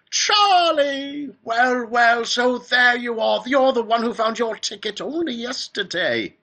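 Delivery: very high, loud, drawn-out delight; Willy Wonka. A narrator's reading. Factory owner greeting the last child, high and delighted. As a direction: shriek the greeting with fluty glee. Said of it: "His voice was high and fluty".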